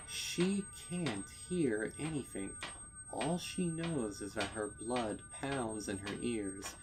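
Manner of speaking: ordinary delivery, nothing marked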